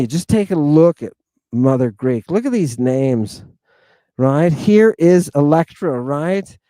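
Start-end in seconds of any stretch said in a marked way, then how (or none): none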